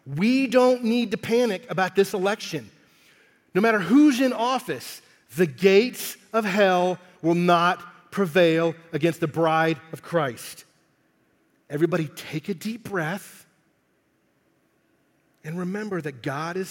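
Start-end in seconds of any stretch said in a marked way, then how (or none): 0.1-10.6 loud, slowed, fiery preaching build; preaching at full force. Acted. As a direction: proclaim it to the whole church, full voice. Rising preaching climax.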